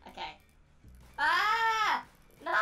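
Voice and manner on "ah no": normal talking voice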